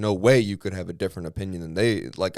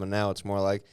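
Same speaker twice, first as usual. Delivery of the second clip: usual speaking voice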